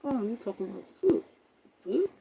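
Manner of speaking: soft, indistinct voice as through a wall; muffled voice. Shown as a demonstration. Imitating the unseen ghost talking inside the camper, muffled. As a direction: mumble it as if heard from outside. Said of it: "It sound muffled".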